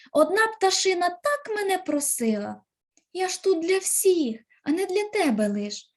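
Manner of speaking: high, pleading voice of the rosehip; pleading cry. Acted. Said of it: "Вона кричить". (благальний високий голос)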